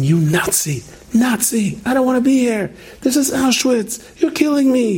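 put-on shrill the old man's panic in a high voice; high mock yell of a confused patient; imitating a screaming old man. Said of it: "this man was screaming at me".

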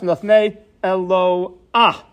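"Midlafnei Eloah" read slowly, "Eloah" as eh-LO-ah with the ah before the final Hey; careful verse reading. Shown as a demonstration. Teacher rereading the verse "Midlafnei Eloah" slowly as eh-LO-ah. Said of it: "Patach under the Aleph".

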